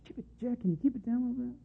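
soft, embarrassed hush; whisper-ish plea to tone it down; imitating an embarrassed onlooker softly. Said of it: "you could almost see it".

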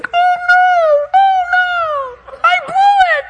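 very high, hammed-up cry of defeat; exaggerated high-pitched loser's lament; Satan's wail. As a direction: wail in mock despair. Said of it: "Satan's gonna be".

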